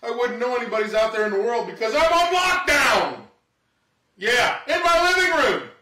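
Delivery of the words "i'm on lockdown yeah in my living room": louder and higher, indignant punchline; comic outraged yell; mock outrage shout. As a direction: yell it like the living room is prison. Comic rant about lockdown.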